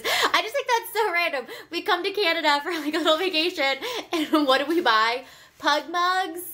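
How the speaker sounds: giggly, amused, high; laughing. Real, not acted. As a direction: laugh through the list. Amused at their own shopping.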